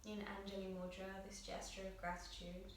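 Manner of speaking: soft, hushed meditation guidance; guided meditation. Acted. Yoga teacher guiding students through breath on their mats, soft meditative voice. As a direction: guide the class gently into breath awareness.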